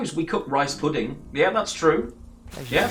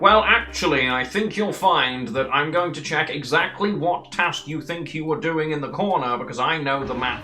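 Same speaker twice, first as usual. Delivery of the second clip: higher, mock officious know-it-all voice; pedantic know-it-all; 4 semitones higher than usual. Player lecturing others on their task in the game, pedantic know-it-all. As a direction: imitate a nitpicking player correcting everyone. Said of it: "until people were like".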